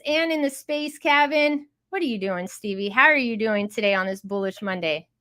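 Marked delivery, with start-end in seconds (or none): none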